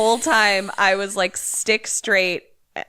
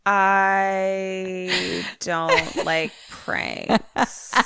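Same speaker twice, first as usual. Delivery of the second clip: very drawn-out tearful whine; whiny dismay; much slower than usual. Real, not acted. Listener reacts to the prank twist, whining slowly. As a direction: whimper each word slowly.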